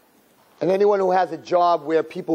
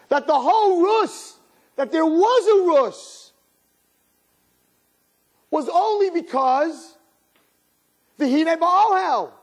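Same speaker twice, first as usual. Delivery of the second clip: preaching at full force; 14 semitones higher than usual. Acted. Preaching climax, high and sustained.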